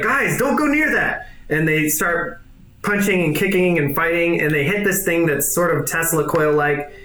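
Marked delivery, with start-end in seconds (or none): 0.0-1.3 high, anxious warning; Doctor Light warning. Acted. Scientist warning the brawling pair, high anxious voice. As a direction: warn them off, pitched up. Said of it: "Doctor Light's like".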